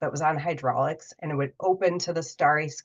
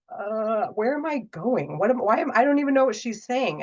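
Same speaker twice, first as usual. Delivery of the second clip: flustered confusion; 5 semitones higher than usual. Retold or imitated. Traveller baffled by the flight attendant's accent, rising confusion. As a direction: sound lost and baffled.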